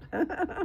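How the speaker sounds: short bright burst of laughter; quick amused laugh; high giggle. Real, not acted. Laughs after describing the song.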